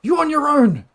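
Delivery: high, loud shout over the shoulder; calling out. Acted. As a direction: call it out to someone left behind. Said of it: "And she calls out".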